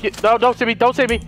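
ordinary talk

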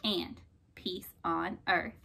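soft, low and slow; gentle blessing. A narrator's reading. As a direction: wish peace tenderly. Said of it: "he said".

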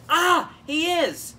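high, loud, drawn-out exclamation; mock shocked realization. Reviewer realizing the secret identity, hammed-up shock. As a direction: cry out at the big reveal.

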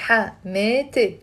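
Hamete split as ha, mee, te; "Hamete" broken up slowly, ha - mee - te; syllable by syllable. Shown as a demonstration. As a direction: say "Hamete" in three separate syllables for learners to repeat. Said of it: "Three syllables".

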